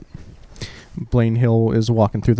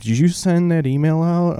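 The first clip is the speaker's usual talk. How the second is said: lightly voiced boss, higher pitch; imitated boss; 7 semitones higher than usual. Boss checks on the email, mildly mocked in higher voice. Boss questions employee, acted in story.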